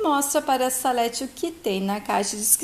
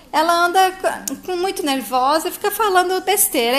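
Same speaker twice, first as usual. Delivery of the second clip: higher, louder, stumbling dismissal; flustered explaining; 6 semitones higher, 4 dB louder than usual. A narrator's reading. Mother explains grandmother away to daughter, flustered. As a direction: brush it off, a touch agitated.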